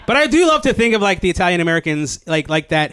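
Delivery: ordinary delivery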